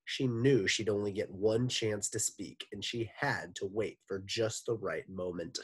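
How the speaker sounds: plain reading voice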